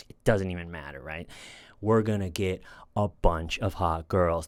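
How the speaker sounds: a low, laid-back hustler pitch, lightly done. Retold or imitated. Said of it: "[name] pitching that idea to a friend".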